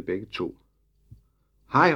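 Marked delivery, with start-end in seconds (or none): 1.7-1.9 calling out. Acted. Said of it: "råbte Emil".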